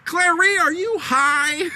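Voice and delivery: high, incredulous movie-quote voice; mock outrage. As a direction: act out an indignant question, stretching the end. Acting Weezer's outraged question.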